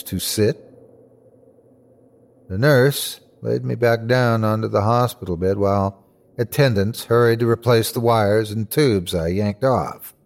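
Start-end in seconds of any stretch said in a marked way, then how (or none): none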